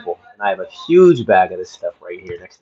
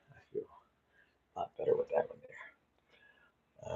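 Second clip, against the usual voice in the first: whisper; 4 semitones lower, 22 dB quieter than usual. Real, not acted. Door shut, wife nearby.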